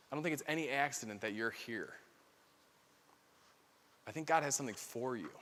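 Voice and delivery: quiet, earnest and low; soft insistence. Real, not acted. Preacher to retreat group, soft and earnest. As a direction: lean in and say it softly.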